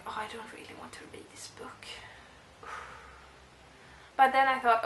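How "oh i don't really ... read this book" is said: whispered, as if confessing to herself; a secret whisper of reluctance; reluctant whisper. Retold or imitated. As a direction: breathe it out, not wanting to read it. Retold reluctant thought at home.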